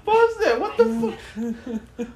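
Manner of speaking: very high, squealed through real laughter; helpless laughing. Real, not acted. Comedian begging for a pause in the video, helpless laughing. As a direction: blurt it out while cracking up, voice shooting up.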